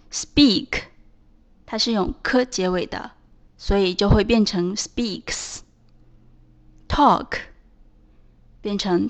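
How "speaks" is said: "speaks" closing on k plus hissed s; voiceless S ending. Shown as a demonstration. Teacher changing speak, "speaks" ending in voiceless s. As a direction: finish "speaks" with an unvoiced ks. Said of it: "那加的S发音就是S".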